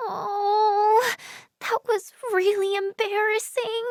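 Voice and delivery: high, small embarrassed whine; mortified groan. Acted. Owning up to mistaking a friend, embarrassed. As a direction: shrink away softly in embarrassment.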